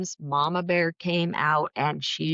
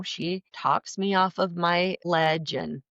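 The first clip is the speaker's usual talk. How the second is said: usual speaking voice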